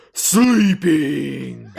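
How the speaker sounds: loud, drawn-out booming pronouncement; a loud booming single-word retort. Acted. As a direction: boom the one word like a sleepy lord. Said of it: "in a booming voice".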